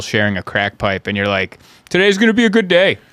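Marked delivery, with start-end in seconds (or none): 1.9-3.0 high, loud, fast forced optimism; pep-talk yourself, hammed up; mock cheerful. Waking in the flophouse, cheering himself up with forced optimism.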